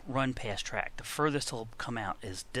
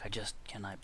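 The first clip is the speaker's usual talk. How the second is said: softer whispered muttering; 3 semitones lower, 7 dB quieter, faster than usual. Real, not acted. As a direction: breathe the words under tension. Tense maneuver.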